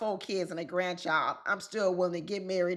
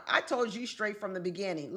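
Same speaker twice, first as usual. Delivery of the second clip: usual speaking voice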